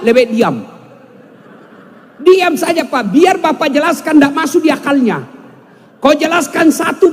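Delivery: usual speaking voice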